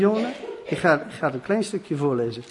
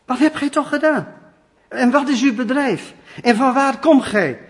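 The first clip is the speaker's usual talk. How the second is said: alarmed sailors; 6 semitones higher, 5 dB louder than usual. Acted. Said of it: "En dan zeggen ze tegen hem".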